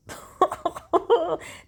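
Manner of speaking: a sudden high burst of laughter; high cackle. Real, not acted. Mocking his misspelled curse word, she breaks into high laughter. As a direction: crack up high at the bad spelling.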